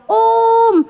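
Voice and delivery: put-on high, slow child-like yell of om telolet om; high drawn-out chant at the bus; calling out. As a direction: shout to the passing bus like an excited kid. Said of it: "sambil teriak".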